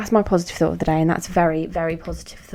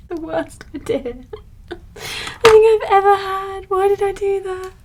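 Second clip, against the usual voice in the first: much higher, whiny despair over the makeup; mock despairing wail; 11 semitones higher than usual. Real, not acted. Woman regretting her ruined glitter makeup, whiny wail. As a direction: wail like it's all ruined.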